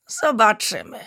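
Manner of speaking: usual speaking voice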